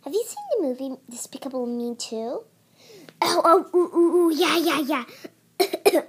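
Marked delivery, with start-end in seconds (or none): 3.2-6.0 excited. Real, not acted. Excited about the movie.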